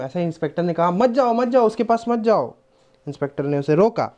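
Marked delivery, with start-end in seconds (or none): 0.9-2.5 urgent warning. Acted. Inspector keeps Leela from the thief, urgent warning. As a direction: call out to stop her.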